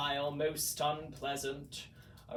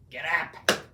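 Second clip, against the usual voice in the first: command to Max; 7 dB louder than usual. A narrator's reading. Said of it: "Then the Grinch said".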